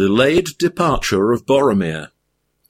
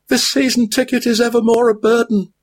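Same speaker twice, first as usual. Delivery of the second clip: high weary hobbit voice; a high-pitched hobbit worn out by his burden; weary Frodo; 14 semitones higher, faster than usual. A narrator's reading. Frodo's held figure voice.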